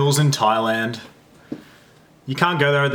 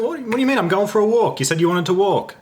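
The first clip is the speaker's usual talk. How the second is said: exasperated retort; 7 semitones higher than usual. Retold or imitated.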